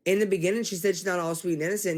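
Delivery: ordinary talk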